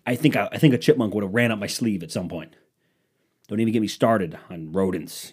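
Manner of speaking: usual speaking voice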